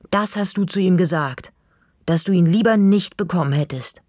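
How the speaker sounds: can't hold it in any longer; blurting out. A narrator's reading. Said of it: "Plötzlich kann ich den Mund nicht halten".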